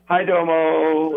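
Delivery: "Hi domo" said slowly as two clear words; Japanese greeting. Retold or imitated. Greeting customers leaving a restaurant, slow "Hi domo". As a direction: stretch out "Hi domo" politely. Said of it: "you hear".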